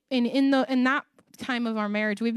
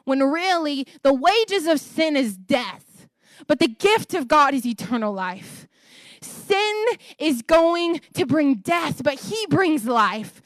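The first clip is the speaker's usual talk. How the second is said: slow, emphatic, louder preaching of scripture; forceful preaching; 4 semitones higher, 4 dB louder than usual. Acted. Preacher to congregation on sin and life, slow and forceful.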